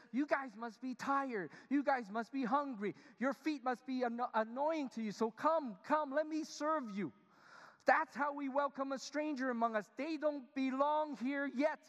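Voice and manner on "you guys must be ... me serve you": Abraham welcoming. Retold or imitated. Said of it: "and he says".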